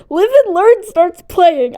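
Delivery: high, loud and drawn-out excitement; thrilled fan outburst. Real, not acted. Fan retelling the movie's big moment, thrilled outburst. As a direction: belt out the title like a thrilled fan. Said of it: "I was freaking out in the theater".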